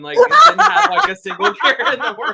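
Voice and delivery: high, loud, laughing through the words. Real, not acted. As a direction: crack up while finishing the story. Laughing at own embarrassing story.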